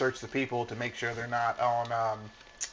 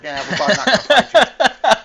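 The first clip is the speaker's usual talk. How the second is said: mock dismissive shout; 9 semitones higher, 11 dB louder than usual. Mock reply to juiced fighter.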